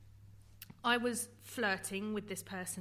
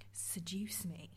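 slow, breathy whisper, softer; 3 semitones lower, 7 dB quieter, slower than usual. Retold or imitated. Man whispering to a girl at a party, slow and soft. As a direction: whisper it slowly into her ear. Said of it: "And then he just went".

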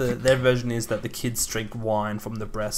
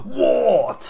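shouted war-cry water; 3 semitones higher, 6 dB louder than usual. Shown as a demonstration. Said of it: "sounds like you are declaring war".

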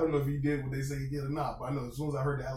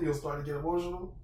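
ordinary talk, nothing marked

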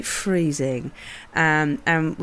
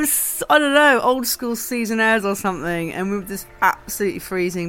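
ordinary talk, nothing marked

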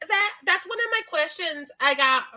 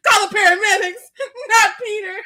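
hammed-up panicked mother, higher and louder; mock hysteria; 7 semitones higher, 7 dB louder than usual. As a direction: cry out for the paramedics like a frantic mum. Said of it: "gets right on this delirious train".

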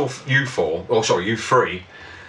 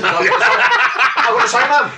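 laughing mock line; 11 semitones higher, 3 dB louder, much slower than usual.

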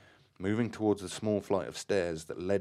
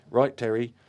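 a loud, higher call out; sudden cry; 5 semitones higher, 9 dB louder than usual. A narrator's reading. Graham suddenly crying out to Terry, loud. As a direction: cry it out urgently. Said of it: "so Graham current suddenly cried".

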